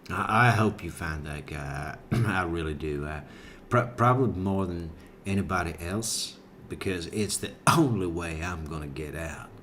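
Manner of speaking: low, mumbled drawling suspect; Chris McNabb impression. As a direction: drop into a low, halting mock voice. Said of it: "now Chris McNabb's over here saying".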